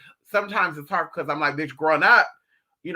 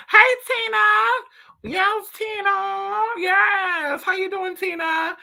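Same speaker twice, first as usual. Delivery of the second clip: much higher, bright calling-out voice; high sing-song greeting; 14 semitones higher, 3 dB louder, much slower than usual. Pretend. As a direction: greet her like an old friend, way up high.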